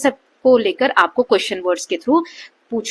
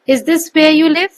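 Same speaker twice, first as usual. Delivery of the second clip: slow question rising at the end; rising yes-no tune; 6 semitones higher, 5 dB louder, much slower than usual. Shown as a demonstration. Teacher reads a yes-no question, rising at the end. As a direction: lift "live" up. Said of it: "last में tone high हो रहा है".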